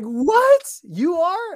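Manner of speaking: very high, loud "What?" of astonishment; shocked disbelief. Retold or imitated. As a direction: squeal it like you can't believe it. Retold shock at shared consecration.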